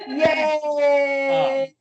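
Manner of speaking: high cheerful yay; cheering. Acted. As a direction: cheer it brightly. Forced cheer at tiny news.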